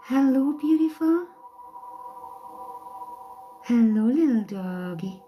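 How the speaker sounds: high cooing voice for a pet. Real, not acted. Owner greeting her dog Poppy in high cooing baby talk.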